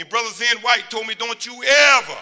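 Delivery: preaching shout. Real, not acted. Preacher passing on his mentor's advice, shouting. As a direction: build to a hard shout on ever.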